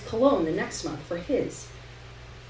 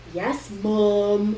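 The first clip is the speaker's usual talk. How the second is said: sarcastic drawn-out. A narrator's reading. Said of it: "the O drawn out and sarcastic".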